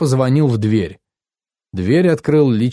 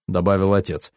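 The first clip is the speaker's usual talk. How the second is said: usual speaking voice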